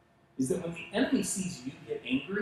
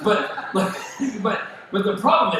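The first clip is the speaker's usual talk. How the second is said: chuckling through the words, a bit louder; laughing; 5 semitones higher, 6 dB louder than usual. Real, not acted. Pastor joking to congregation, laughing. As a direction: laugh at your own joke. Laughing at his own joke.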